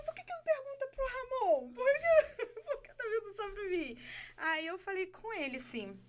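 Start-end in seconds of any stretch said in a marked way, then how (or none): 0.0-4.0 high and laughing, flustered; retelling a flustered reply, laughing through it; nervous laughing. Retold or imitated. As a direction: laugh nervously while protesting. Retold nervous reply to the boss.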